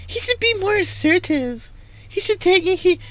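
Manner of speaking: very high mock girly voice; mocking passengers. Driver mimicking passengers urging him on, very high squeaky mimicry. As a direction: squeak it like a back-seat critic. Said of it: "funny the way they were saying it".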